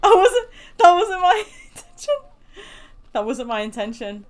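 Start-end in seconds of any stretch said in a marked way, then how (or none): 0.0-2.2 laughing apology. Real, not acted. Killed a dog in game.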